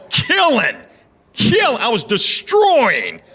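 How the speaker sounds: drawn-out triumphant shout; excited boast. Retold or imitated. Comic bragging to the crowd about a great set, shouting.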